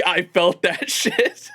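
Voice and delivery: slow words dissolving into real laughter; breaking into laughter. Real, not acted. Streamer recalls a funny line he felt, real laughing delivery. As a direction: crack up while finishing the sentence. Cracking up over a remembered line.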